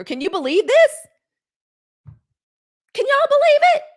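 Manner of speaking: rising to a loud, high cry of disbelief; outraged exclamation. Real, not acted.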